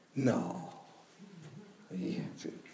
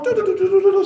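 higher sung doo beeps of dialing; phone dial tones; 7 semitones higher, much faster than usual. Retold or imitated. Woman dialing her friends, imitating the keypad beeps.

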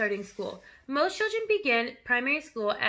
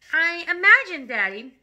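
ordinary delivery

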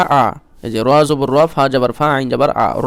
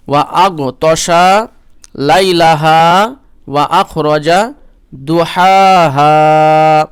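higher, louder, melodic tilawat; Quran recitation; 5 semitones higher, 7 dB louder, much slower than usual. Acted. Teacher recites the verse for students in tilawat. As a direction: lift the verse into a full chant.

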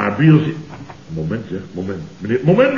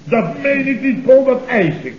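pushing the point with a raised voice; insistent; 8 semitones higher than usual. Acted. Boss demanding a slap from Paul, high and insistent.

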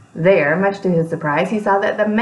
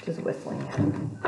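hushed whisper aside; 4 semitones lower, 11 dB quieter than usual. Real, not acted. Aside to the children.

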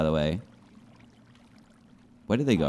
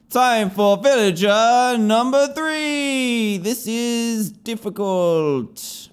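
dramatic announcer; 8 semitones higher, much slower than usual. Pretend.

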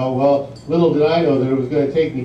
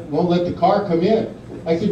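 normal talking voice

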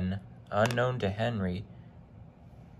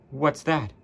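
higher, louder startled question; 6 semitones higher, 5 dB louder than usual. A narrator's reading. Driver spotting a strange sight ahead, startled. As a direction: ask in sudden alarm.

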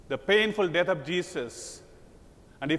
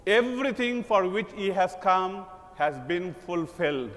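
ordinary talk, nothing marked

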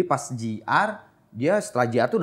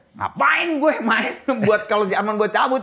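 higher-pitched mock indignation, drawn out; imitated angry protest; 8 semitones higher, slower than usual. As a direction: play an indignant refusal, half laughing. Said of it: "Marah dia sampai ditahan".

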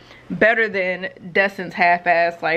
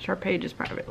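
murmuré tout bas, sans voix; glisse-le en aparté, chuchoté; whisper; 10 dB quieter than usual. Real, not acted. Aside about private page.

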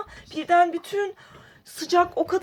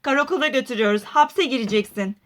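deep police officer voice; 7 semitones lower, faster than usual. Acted. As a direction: sound like a stern police officer. Polis rolü, alçak ses. (tutuklayan sert polis sesi)

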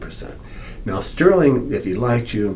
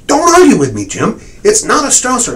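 loud, high-pitched stubborn denial; angry shout; 13 semitones higher, 10 dB louder than usual. Retold or imitated. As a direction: bark it back as the last word. Sterling retold, arguing back hard.

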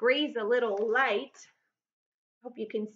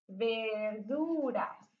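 very slow, stretched syllables in VERDURAS; drawn-out dictation; 6 dB quieter, much slower than usual. Shown as a demonstration. Teacher dictating vegetables in Spanish, drawn out. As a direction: sound out verduras softly, lingering on each syllable. Sounds out word while writing it.

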